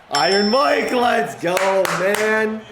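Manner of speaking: loud, high celebratory yell; real burst of gamer hype; hyped celebration. Real, not acted. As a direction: cheer your star receiver on loudly. Celebrating a catch by Mike Williams.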